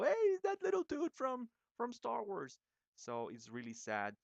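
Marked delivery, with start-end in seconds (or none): 0.0-2.6 very high, loud mock-excited squeal; imitated excited recognition. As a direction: exclaim it sky-high like a thrilled onlooker. People recognizing the droid, acted out.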